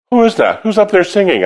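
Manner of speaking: surprised director. Retold or imitated. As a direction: imitate a stunned teacher calling out. Choral director hearing her sing.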